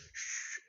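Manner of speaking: a sharp, very soft shushing hiss; imitated shush. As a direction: shush someone through a window, barely voiced. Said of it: "He goes".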